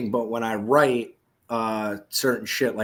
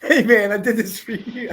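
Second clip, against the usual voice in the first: high, loud, through laughter; laughing; 11 semitones higher, 6 dB louder than usual. Real, not acted. Dyslexic host joking back to his co-hosts, laughing. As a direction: crack up while saying it.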